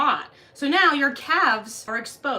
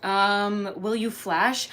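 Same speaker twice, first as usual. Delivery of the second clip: normal talking voice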